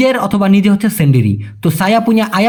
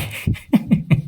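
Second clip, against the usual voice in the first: soft breathy chuckle; low-volume giggling in short bursts; 6 dB quieter than usual. Real, not acted. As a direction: laugh quietly at your own silly example. Amused by cat-poo example sentence.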